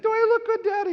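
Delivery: put-on very high little boy voice; a hopeful four-year-old asking; high, loud mock toddler voice; imitating small child. Dad voicing his small son.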